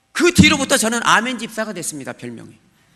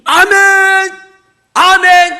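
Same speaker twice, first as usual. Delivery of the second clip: shouted amens, much higher; loud amen; 9 semitones higher, 12 dB louder, much slower than usual. Retold or imitated. A worshipper shouting amen during the sermon tape. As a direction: bellow amen alone in the hall. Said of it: "혼자 크게".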